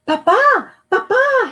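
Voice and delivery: high breathless call; boy calling his father, out of breath; panted. Acted. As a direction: gasp it out after running. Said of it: "he panted".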